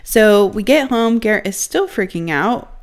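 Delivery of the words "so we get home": ordinary delivery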